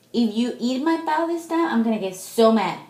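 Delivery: high, loud scolding threat; angry warning. Real, not acted. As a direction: shout a warning at the dog. Threatening the dog.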